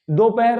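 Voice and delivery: दोपहर with a long "o": "do-pahar"; दोपहर opening on "do", not "du"; mistaken pronunciation. Shown as a demonstration. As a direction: say दोपहर as "doh-pa-har". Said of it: "दोपहर नहीं".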